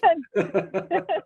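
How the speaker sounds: high trailing laugh. Real, not acted. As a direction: let the laugh run out. Laughing off swallowing a bug.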